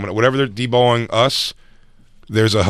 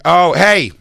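higher, louder imitated summons; mock beckoning; 6 semitones higher, 4 dB louder, much slower than usual. Imitating women after shows, mock beckoning. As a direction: call them over slowly in imitation.

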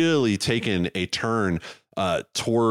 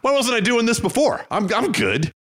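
much higher, louder, breezy mock voice; mock surprised athlete; 13 semitones higher, 3 dB louder than usual. Teasing a cohost about first skating, in a high mock voice. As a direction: play the effortless natural, pitched way up.

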